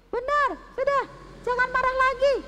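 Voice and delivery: high, loud triumphant queen; gleeful queen. Acted. Queen telling the king good news, high and gleeful. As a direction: announce the prize with glee.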